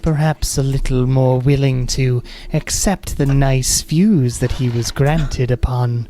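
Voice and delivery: slow, eerie ladylike voice; creepy lady voice. Acted. Eerie glade voice tells her about the boyfriend, creepy lady voice. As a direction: linger creepily over the words. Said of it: "Was a pretty creepy lady voice".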